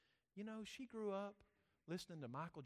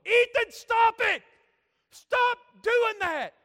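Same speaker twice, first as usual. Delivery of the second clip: high, slow full-out scream at a brother; Owen yelling; 24 dB louder, slower than usual. Retold or imitated. Owen screaming at his brother Ethan to stop, yelling. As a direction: yell it at the top of your voice, drawn out. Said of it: "I heard the awfulest yelling".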